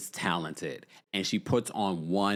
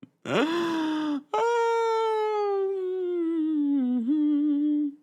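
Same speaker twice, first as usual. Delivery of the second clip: laughter stretching into a long, high held note; real high-pitched laughing fit; high squealing laugh. Real, not acted. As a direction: crack up into a sustained high squeal. Cracking up at own bonjour joke.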